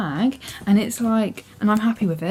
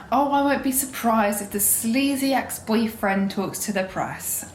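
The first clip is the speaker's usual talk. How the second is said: normal talking voice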